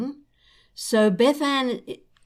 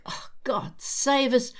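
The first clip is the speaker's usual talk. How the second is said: under her breath, all air; whispered aside; 4 semitones higher, 4 dB quieter than usual. Real, not acted. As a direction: whisper it to yourself in exasperation. Private aside about crying mums.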